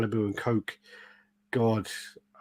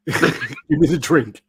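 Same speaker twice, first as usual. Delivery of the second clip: stern bouncer; 4 semitones higher, 7 dB louder, much slower than usual. Retold or imitated. Bouncer voiced in story.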